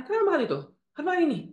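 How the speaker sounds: high-pitched nagging questions; mock a fault-finding mother; critical mother. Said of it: "Kita di-, dikritis". (ibu mencela dengan nada tinggi)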